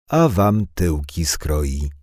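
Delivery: put-on second thug threatening the boys, slow and menacing; slow menace. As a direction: spell out the threat slowly, word by word.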